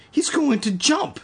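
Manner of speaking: high panicked thought; rising panic. Acted. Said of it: "Evan thought".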